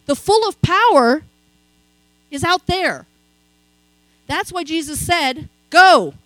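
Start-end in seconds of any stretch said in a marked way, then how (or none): 0.1-3.0 1 emphatic. Real, not acted. Preacher contrasts church with outside. 5.7-6.1 loud, ringing command; booming preacher's call to go; commanding shout. Acted. Quoting Jesus' command.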